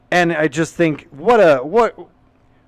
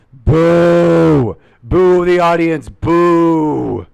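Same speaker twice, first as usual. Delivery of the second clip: loud drawn-out boo; mock booing; 2 semitones higher, 7 dB louder, much slower than usual. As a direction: boo the crowd like a heckler. Booing the audience back.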